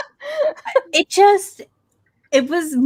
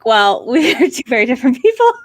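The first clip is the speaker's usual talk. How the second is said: raised voice breaking into laughter; louder, tickled retelling of a comeback; laughing retort; 6 semitones higher, 5 dB louder than usual. Retold or imitated. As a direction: laugh through a loud, amused comeback. Retorting to a commenter, cracking up.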